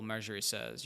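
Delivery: ordinary delivery, nothing marked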